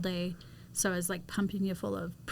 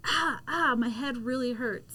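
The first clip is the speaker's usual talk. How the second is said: higher, louder imitation of someone hurting; mock groan of pain; 5 semitones higher, 4 dB louder than usual. Wife imitating her husband rubbing his hurt head, mock pained. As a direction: play up a sore head, pitched up. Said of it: "and go like".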